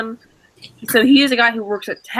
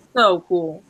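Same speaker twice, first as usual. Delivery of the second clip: ordinary talk, nothing marked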